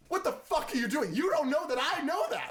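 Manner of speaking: high, loud disbelieving outburst; mock outrage, voice jumping up high; incredulous. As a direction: blurt it out in shocked disbelief. Acting out Lois's reaction to Clark.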